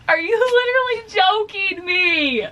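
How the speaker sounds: loud, high tearful wail; crying. Real, not acted. Reader crying at the author's plot twist, tearful wail. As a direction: wail it in upset disbelief. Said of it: "Why am I already crying?".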